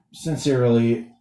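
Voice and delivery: ordinary talk, nothing marked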